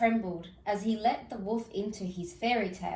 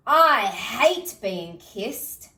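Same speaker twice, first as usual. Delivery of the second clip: loud, slow disgusted complaint; 9 dB louder, much slower than usual. Acted. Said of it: "spluttered the big bad wolf".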